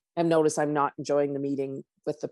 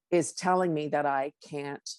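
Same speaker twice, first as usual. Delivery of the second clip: ordinary delivery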